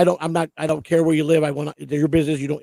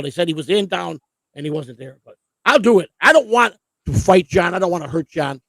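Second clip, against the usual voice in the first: ordinary delivery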